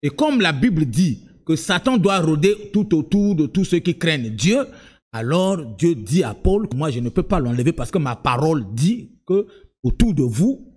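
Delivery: ordinary talk, nothing marked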